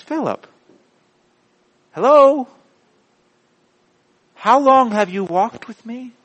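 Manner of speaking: exasperated, high-pitched pleading questions; Jesus. Acted. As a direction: press each question in disbelief, pausing between. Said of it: "I can just see him going".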